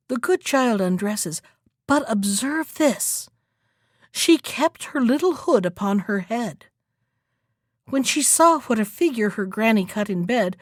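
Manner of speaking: ordinary delivery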